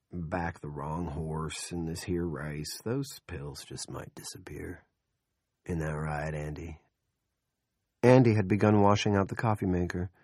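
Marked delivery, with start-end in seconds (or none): 0.1-6.8 slow whispered threat; menacing murmur. A narrator's reading. Big Jim hinting at her pills, soft whisper. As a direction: let the threat sink in softly. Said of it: "dropped to a murmur".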